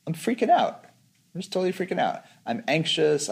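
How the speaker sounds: high-pitched anxious self-labelling, acted lightly; mock freak-out. Meditator labelling their own state, mock freak-out. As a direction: imitate your own panic in a high voice. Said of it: "I'm kind of freaking out, I'm getting all anxious".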